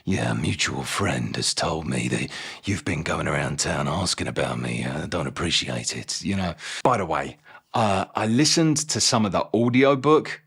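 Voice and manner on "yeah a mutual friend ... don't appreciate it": low, quiet menacing Cockney confrontation; hushed, low-pitched villain warning; Mister Hyde impression. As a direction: lean in low and soft, quietly threatening. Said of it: "he kind of goes".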